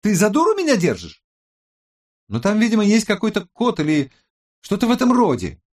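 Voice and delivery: high, loud, indignant woman's voice; snappish. A narrator's reading. Tech expert snaps at her doubting colleague, high and indignant. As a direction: bristle at the doubt, pitched right up. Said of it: "огрызнулась Роза".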